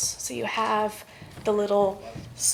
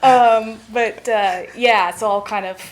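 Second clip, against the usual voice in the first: teeth chattering from the cold; shaky cold voice, freezing; shivering; 14 dB louder than usual. Real, not acted. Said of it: "I'm freezing here".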